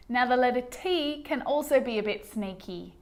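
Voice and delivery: ordinary talk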